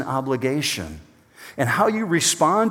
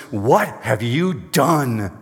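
very slow, every word pressed; emphatic; much slower than usual. Acted. Sailors pressing Jonah for answers, slow and emphatic. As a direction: drag it out with heavy weight. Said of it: "Emphatically saying".

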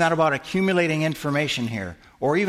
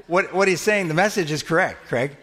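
ordinary delivery

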